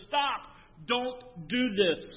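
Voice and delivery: retold high, loud cry to stop him; a loud slow plea. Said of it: "Paul cries out".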